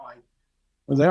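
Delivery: ordinary talk, nothing marked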